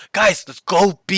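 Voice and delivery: high, loud command; excited team call. Retold or imitated. Coach re-enacting student's call to go B, excited. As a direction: call the team over urgently.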